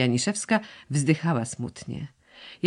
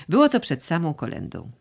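ordinary delivery

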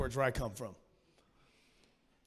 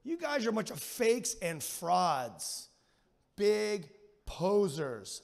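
mocking posers; 5 semitones higher, 5 dB louder, much slower than usual. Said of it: "He's like".

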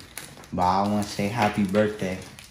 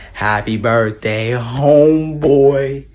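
loud; 5 semitones higher, 7 dB louder, much slower than usual. Real, not acted. Drawn-out shouted greeting.

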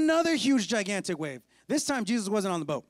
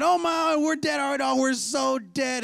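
higher, louder cry of doom; mock panic; 6 semitones higher, 4 dB louder than usual. Disciples seeing a ghost figure, hammed-up panic. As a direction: wail it, dragging out the end.

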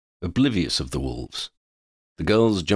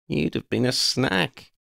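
slow, higher teasing threat; 8 semitones higher than usual. A narrator's reading. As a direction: drag out the joke playfully. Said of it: "Lydia continued".

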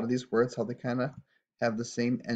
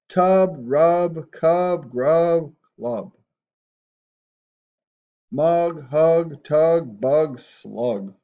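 higher and much louder phonics calling; loud read-along; 4 semitones higher, 9 dB louder, much slower than usual. Shown as a demonstration. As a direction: shout each word out cheerfully for the class. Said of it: "Try and say these with me".

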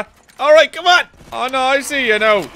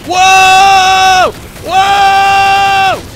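startled whoa; 9 semitones higher, 10 dB louder than usual. Real, not acted. Startled in-game.

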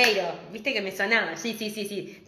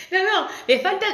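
laughing; 9 semitones higher than usual. Real, not acted. Laughing at friend's costume.

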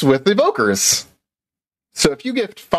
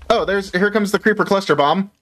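ordinary talk

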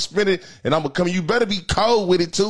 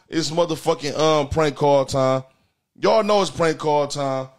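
show announcement; 5 semitones lower, slower than usual. Acted. Announces the segment.

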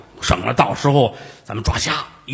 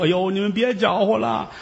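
put-on muddled old aunt; 11 semitones higher, 3 dB louder than usual. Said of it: "老太太糊涂".

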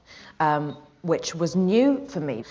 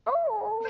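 high, thin mock howl; dying dog whimper. Actor playing a dying dog, high drawn-out whine. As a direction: imitate a dog whining, pitched way up. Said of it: "And [name] goes".

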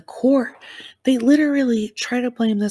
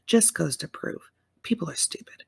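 a catty whispered comment; whispered aside; 2 semitones lower, 5 dB quieter than usual. Real, not acted. Whispering a snide aside to her viewers. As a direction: whisper the jab under her breath.

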